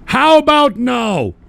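loud, high, slow holler; shouted refusal. Real, not acted. Host repeating his no to a listener, shouting slowly. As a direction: yell it back with a drawn-out no.

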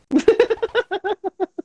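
high shrieking burst of laughter. Real, not acted. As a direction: cackle loudly in disbelief.

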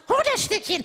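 loud, high "fetch the stick" to a dog; fetch command. Acted. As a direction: call it like to a dog.

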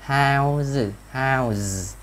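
"How's" ending in a long buzzed z; isolated "How's", voice kept on through the z; voiced Z ending. Shown as a demonstration. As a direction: draw out the z on "How's". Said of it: "thành z".

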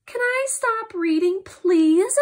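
"Can I stop reading, please" high and rising; question tune. Shown as a demonstration. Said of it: "a question mark".

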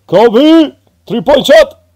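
loud, high basketball call; hyped sports shout. Pretend. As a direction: yell it like a buzzer-beater. Throwing trash like a basketball shot.

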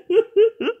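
high laugh bursting out; high burst of laughter. Real, not acted. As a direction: laugh out loud. Laughs hard.

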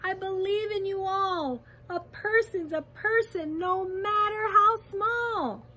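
high, loud impassioned encouragement; rally them with urgent conviction; calling out. A narrator's reading. Captured elephant calling encouragement to the mayor, calling out. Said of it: "managed to call to the mayor".

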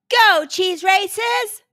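high, loud, drawn-out cheer; crowd shout. A narrator's reading. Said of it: "the crowd shouted".

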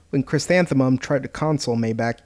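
plain reading voice

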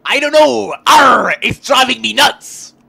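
loud, slow pirate growl. Acted. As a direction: shout it like a pirate. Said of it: "the pirate says".

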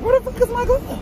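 high, loud cry of frustration; frantic wail. Real, not acted. Driver hunting for a lost glove, frantic high wail.

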